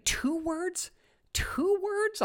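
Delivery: high, hushed questioning; soft and high, as if musing to oneself; puzzled thinking aloud. Retold or imitated. Host replaying his thoughts at a headline, puzzled and hushed.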